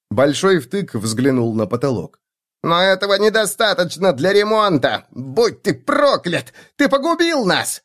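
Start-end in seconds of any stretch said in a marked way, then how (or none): 2.6-7.8 high shrill lament; wailing halfling. A narrator's reading. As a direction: curse the dwarf in despair. Halfling cursing.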